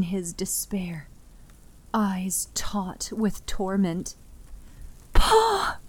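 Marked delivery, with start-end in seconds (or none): 5.1-5.8 high, pleading cry; a daughter calling her stunned father; desperate cry. Acted. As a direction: reach him with one broken word. Daughter kneels by grieving father.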